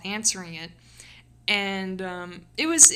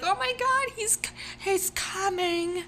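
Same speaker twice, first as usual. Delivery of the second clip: much higher, hammed-up shocked bystander; mock onlooker; 11 semitones higher than usual. Imagined onlookers gasp at the streamer, hammed-up high. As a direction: squeal it like a scandalised stranger.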